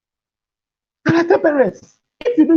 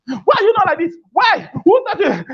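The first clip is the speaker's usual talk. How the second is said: mock parent scolding; 8 semitones higher than usual. Parent yelling at child, acted.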